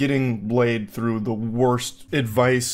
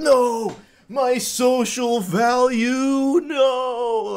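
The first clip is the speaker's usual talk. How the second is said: high, slow, hammed-up wail of despair; mock anguished wail; 11 semitones higher, much slower than usual. Guy patted on the shoulder, hammed-up high wail of loss. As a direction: wail like your social value is dying.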